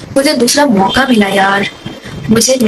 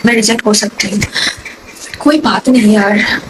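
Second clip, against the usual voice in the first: ordinary talk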